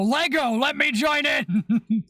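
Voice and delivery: slow booming demon shout; Magnus voice. Retold or imitated. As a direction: bellow it like a daemon prince. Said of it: "and Magnus would go".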